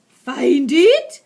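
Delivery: very loud scream. Acted. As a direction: scream the order. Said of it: "screamed the Grand High Witch".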